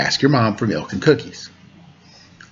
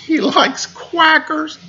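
"He likes quackers" squeaked far higher, slowly; high squeaky punchline; much slower than usual. Shown as a demonstration. Host repeats the duck punchline to kids in a high squeak. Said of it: "that made me laugh".

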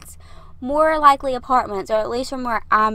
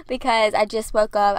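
ordinary talk, nothing marked

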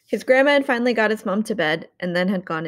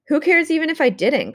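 shouted back; 8 semitones higher than usual. A narrator's reading. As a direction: yell it back at him, exasperated. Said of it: "Connor shouted back".

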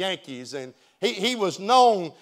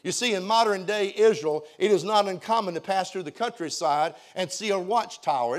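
ordinary delivery

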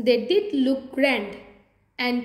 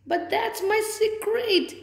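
high, drawn-out protest; storybook wail of a wronged squirrel; 8 semitones higher than usual. A narrator's reading. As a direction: wail it slowly in dismay. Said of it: "wailed Bushy".

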